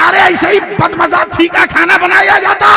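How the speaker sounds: high, loud fake angry outburst; mock outrage. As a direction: bellow in pretend disgust. Father-in-law fakes anger at food. (ससुर का नकली गुस्सा)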